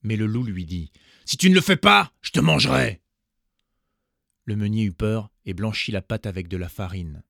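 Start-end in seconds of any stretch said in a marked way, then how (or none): 1.2-3.0 loud menacing threat; threatening wolf. A narrator's reading. Wolf threatening to eat the miller, loud. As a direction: scare the miller into obeying.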